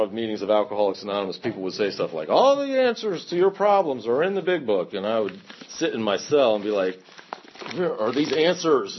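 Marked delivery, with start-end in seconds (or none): 2.3-4.9 raised, emphatic meeting-room platitude; mock preachy slogan. Quoting meeting regulars to the audience, mock preachy. 7.8-9.0 slow, higher-pitched incredulous question; exasperated searching. Retold or imitated. Recalling himself searching in his cell, exasperated.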